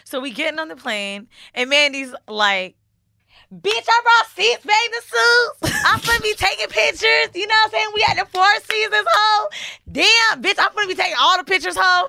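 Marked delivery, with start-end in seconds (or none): 3.5-12.1 much higher, louder, hammed-up friend voice; impersonation of Mandy. Co-host mocking Mandy's bathing-suit plans in a much higher, louder hammed-up voice. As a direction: squeal it like an overexcited friend. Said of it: "that was not a good impersonation".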